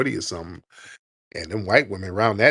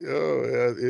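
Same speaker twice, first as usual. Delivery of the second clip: ordinary talk, nothing marked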